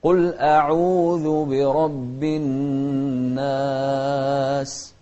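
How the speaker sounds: slow melodic chanted recitation; Quran recitation. Shown as a demonstration. Teacher reciting the verse for the child to repeat, slow melodic chant. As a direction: chant the verse slowly, stretching vowels.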